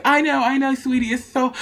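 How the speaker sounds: soothing friend. Retold or imitated. Friend voiced in story.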